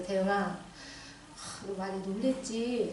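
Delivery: soft, slow, gentle question; mother easing her son after the shock; tender comfort. Retold or imitated. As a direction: comfort your frightened son quietly.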